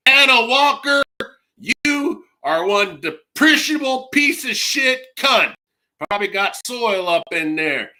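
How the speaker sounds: unload on her, pitched high and raging; furious tirade. Retold or imitated. Caller ranting at the phone agent, furious and high.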